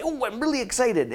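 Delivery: high, gushing imitation of new coaches; imitated bubbly enthusiasm; mock excitement. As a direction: gush it eagerly, lightly sent up. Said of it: "come home and say".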